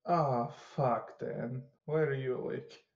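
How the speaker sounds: usual speaking voice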